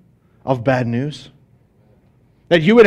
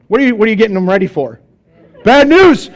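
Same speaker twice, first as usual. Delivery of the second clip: ordinary talk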